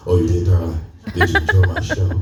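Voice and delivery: a lightly put-on smooth charmer voice; smooth show voice. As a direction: slide into a suave stage persona. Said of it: "the voice kicked in".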